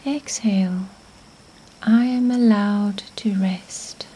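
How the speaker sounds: usual speaking voice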